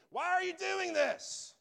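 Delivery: desperate, slow high-pitched yell; screaming. Retold or imitated. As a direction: scream it up at God, drawn out. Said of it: "just screaming".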